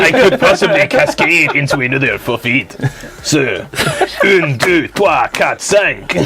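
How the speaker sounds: put-on French accent, counting in French. Counting out five cards at the table, mock French accent. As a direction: play a comic Frenchman. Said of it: "it's still gotta do the French accent".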